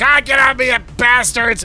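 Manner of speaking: loud, high furious yell at the dogs; angry shout. Acted. Uncle yelling at the nipping dogs. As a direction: snarl it out as dogs bite. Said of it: "and he's like".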